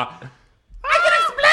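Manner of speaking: very high, slow squeal; panicked shriek. Retold or imitated. Caught naked, panicked cry. (gällt panikskrik)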